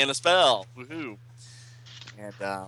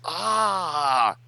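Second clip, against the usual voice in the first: pitched-up mock scream; high villain cry; 8 semitones higher, 3 dB louder than usual. Villain Mordru struck by the spell, high yelp. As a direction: yelp as the smoke hits. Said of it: "and Mordru says".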